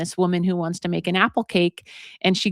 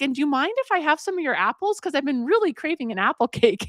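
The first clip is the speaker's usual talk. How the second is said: higher-pitched polite asking; lightly voiced hopeful old woman; hopeful request; 7 semitones higher than usual. Old woman asking neighbor for apples, hopeful.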